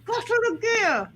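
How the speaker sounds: Gottel of Gear said without moving the lips; ventriloquist phrase. Shown as a demonstration. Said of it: "practicing her Gottel of Gear".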